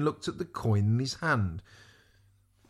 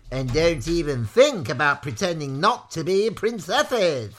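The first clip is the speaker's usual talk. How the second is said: higher, loud scolding threat; villain's warning; 4 semitones higher, 6 dB louder than usual. A narrator's reading. Maudmont replying to Eliza, loud villainous warning. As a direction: cut them off with a loud warning. Villain warns captives in put-on voice.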